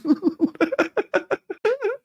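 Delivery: squeaky helpless giggling; giggling at the joke; high giggle fit. Real, not acted. Laughing fit at own joke.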